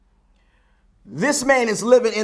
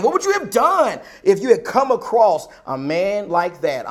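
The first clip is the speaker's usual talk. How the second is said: ordinary talk, nothing marked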